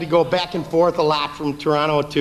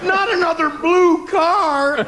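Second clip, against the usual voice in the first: exaggerated panic; 14 semitones higher, 5 dB louder, much slower than usual. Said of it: "He exaggerated to".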